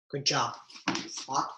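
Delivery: plain reading voice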